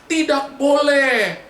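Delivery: high, slow, stretched-out reply; drawn-out teaching answer. Real, not acted. Teacher answering own question to children, high and drawn out.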